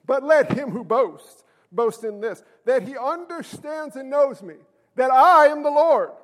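slow, emphatic Bible reading; measured, weighty proclamation; scripture reading. Acted. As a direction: declaim the verse deliberately from the pulpit. Reads scripture aloud.

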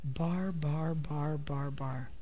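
very soft, low monotone drone; hushed, dreary gibberish on one low note; mimicking unintelligible tongues. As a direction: murmur identical syllables like meaningless babble. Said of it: "it sounds like".

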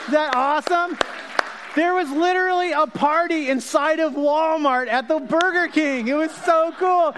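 high, bright-eyed enthusiasm; excited. Real, not acted. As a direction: gush about the party. Excited telling about Walmart party.

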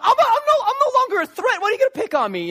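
high, loud mock whine with a laugh; hammed-up squeaky plea of the losing player; whiny underdog. Friends voicing third-place player, mock whine.

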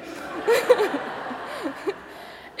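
breathy laugh, high; high airy laugh. Real, not acted. Preacher laughing after her accidental Southern accent, airy laugh. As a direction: giggle at your own accent.

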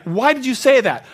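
stunned, demanding to know; shocked question. Retold or imitated. Shocked by friend's words.

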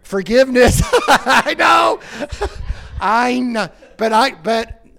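loud, high laughing admission. Real, not acted. Pastor laughs at needing forgiveness, loud and high. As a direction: laugh it off loudly.

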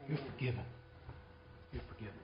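very soft, fading repetition; hushed pardon. Shown as a demonstration. Preacher showing what we need to do, hushed forgiveness. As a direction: say it low, letting it sink in.

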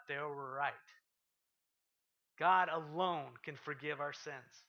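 ordinary delivery

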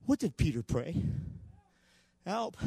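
normal talking voice

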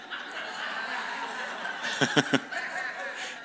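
real laugh breaking out mid-sermon; bursting laugh. Real, not acted. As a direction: laugh at the surprise. Sudden interruption, laughing.

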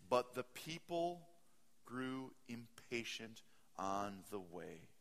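drawn out, very slow delivery; slow reading. Shown as a demonstration. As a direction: stretch every word slowly. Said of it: "If I gave this sermon speaking like this, very slowly".

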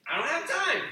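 I don't have time, pitched high and pressed; mock the excuse, high and exasperated; whiny mock excuse. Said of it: "I hear it all the time".

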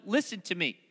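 ordinary talk, nothing marked

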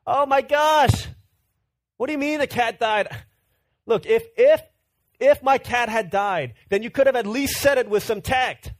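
high, loud, heartbroken cry of disbelief; distraught outburst. Retold or imitated. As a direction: wail it as a brother hearing his cat died. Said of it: "He's heartbroken. He's distraught".

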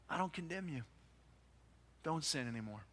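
soft, tender reassurance; Jesus gently forgiving the woman, soft and tender; tender and gentle. Retold or imitated. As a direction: speak it softly, like forgiving a frightened friend. Jesus speaks gently to exposed woman.

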